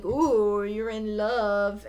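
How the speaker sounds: slow, drawn-out mock teasing; taunting. Manny taunts Paige as she stares at the bus, taunting. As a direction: imitate a sing-along schoolyard tease, stretching it out. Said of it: "Manny kinda tauntingly says".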